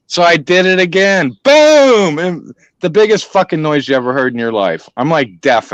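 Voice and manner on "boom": loud high shouted boom like a rifle; mock rifle blast; gunshot boom. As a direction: bark out the shot sound. Imitating a rifle shot.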